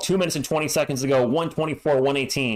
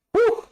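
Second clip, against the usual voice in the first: relieved whoop; 4 dB louder than usual. Real, not acted. After tense possession.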